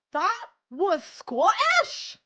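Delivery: slow, loud, high disbelief; incredulous. Real, not acted. Questioning her own accent in loud, slow disbelief. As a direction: drag it out in loud mock outrage.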